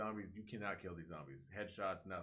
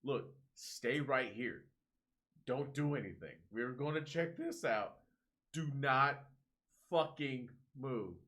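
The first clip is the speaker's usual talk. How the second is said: higher, louder, slowed stern orders; stern instruction; 5 semitones higher, 5 dB louder, slower than usual. Retold or imitated. Movie adults warning the kid to stay put, acted stern orders. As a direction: spell out the warning slowly and emphatically. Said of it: "this kid is being told".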